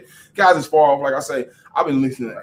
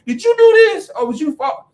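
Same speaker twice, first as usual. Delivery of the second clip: high, louder mock-stern mama; imitating his mama; 7 dB louder than usual. Son quoting mama confronting him, mock-stern and high. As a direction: pitch way up as a scolding mother. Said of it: "my mama said".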